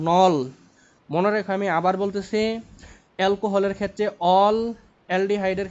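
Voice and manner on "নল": "নল" punched loud as the wrong ending, said with an o sound; wrong ending stressed loud. Shown as a demonstration. Teacher warning students the aldehyde ending is not "নল", said loud. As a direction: set apart "নল" firmly as the ending to avoid. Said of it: "প্রোপানেল কিন্তু, not".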